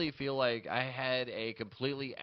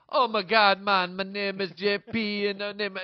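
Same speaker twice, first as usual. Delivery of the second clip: high, loud, hammed-up Jamaican accent; 7 semitones higher, 6 dB louder, slower than usual. Comic mocking the previous act on stage, loud Jamaican accent. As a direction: launch into a broad comic Jamaican patois. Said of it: "I just did the Jamaican voice".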